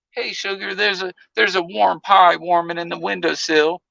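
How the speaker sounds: put-on sweet Southern country accent. To Rain, teasing her Southern country accent. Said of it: "got that country accent".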